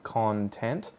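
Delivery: "content" as CON-tent, rising; "content" front-stressed, as the noun; stress on first syllable. Shown as a demonstration. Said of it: "first syllable is stress".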